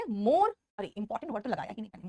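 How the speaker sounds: usual speaking voice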